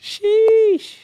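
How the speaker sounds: very high, loud, long squeal; high drawn-out exclamation. Pretend. Friend reacting to joking banter, high loud squealed exclamation. Mock exclamation amid laughter.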